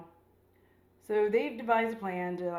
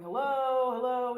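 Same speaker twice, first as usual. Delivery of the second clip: raised, higher calls into emptiness; calling out; 5 semitones higher, 6 dB louder than usual. Retold or imitated. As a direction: call out hello into the deserted street. Calling out in empty London.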